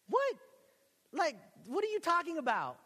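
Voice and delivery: high, loud, incredulous question; hammed-up affronted bewilderment; baffled disbelief. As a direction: balk at the insult, voice shooting up. Retells disbelief at being called punk.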